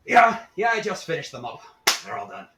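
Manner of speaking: bursts in loud and high, then settles; an eager halfling, too keen to please. Acted. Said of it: "He's so eager".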